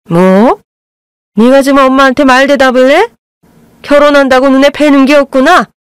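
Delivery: how dare you answer back; mother-in-law furious at her son's backtalk; angry scolding. A narrator's reading. Mother scolds son for talking back.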